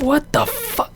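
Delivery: high, crying disbelief; a fan's stunned cry; retold outburst. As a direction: squeal it in shock. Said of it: "I said".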